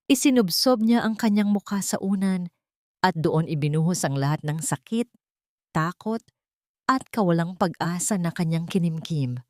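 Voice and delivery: ordinary delivery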